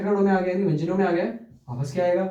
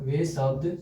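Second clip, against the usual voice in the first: slow dictation with long pauses; teacher dictating the second rule to students; 4 dB quieter, slower than usual. Shown as a demonstration. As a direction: dictate word by word for notes. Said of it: "लिखते जाओ फटाफट".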